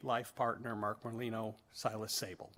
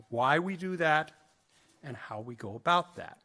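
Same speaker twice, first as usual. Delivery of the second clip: ordinary talk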